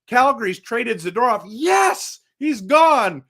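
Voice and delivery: hammed-up relief that the enforcer is gone; mock celebrating rivals. Host mocking Oilers stars cheering a trade, gleefully. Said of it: "when they're like".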